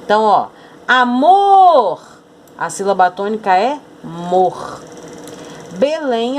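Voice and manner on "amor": "amor" called out high and loud, last syllable stretched; calling the word. Shown as a demonstration. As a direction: call "amor" as if summoning it, holding the final syllable. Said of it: "chame a palavra". (chamando "amor", com a última sílaba forte e alongada)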